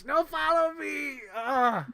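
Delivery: high, loud, drawn-out cry; mock heartbroken wail. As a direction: wail the film line with exaggerated sorrow. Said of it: "it's so sad when he's".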